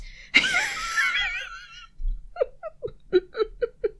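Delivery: shrieking fit of laughter; helpless high laughter. Real, not acted. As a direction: burst out laughing, can't stop. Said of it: "I'm gonna have to end this recording session".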